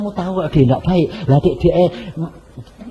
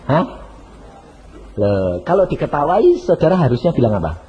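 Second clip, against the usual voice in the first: usual speaking voice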